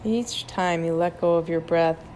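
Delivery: ordinary talk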